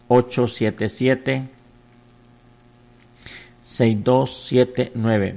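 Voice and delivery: very slow, drawn-out digits in steady groups; phone number read-out. Real, not acted. Pastor repeating the number for voluntary offerings, slow drawn-out read-out. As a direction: stretch each digit in a repeated telethon cadence.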